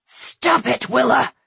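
loud angry shout. A narrator's reading. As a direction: shout it right in her face. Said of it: "Greddick shouted into her face".

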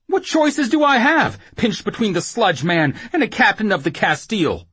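high, loud helpless complaint; frantic rambling. Acted. Whoremaster pinched between two powerful men, frantic rambling. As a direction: bewail your position, high and loud. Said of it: "he rambled".

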